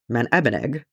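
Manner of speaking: ordinary delivery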